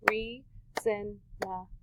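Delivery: very slow, quiet "Reasonable." in beats; slow soft syllables. Shown as a demonstration. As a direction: stretch "Reasonable." softly to count. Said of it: "how many do you guys hear".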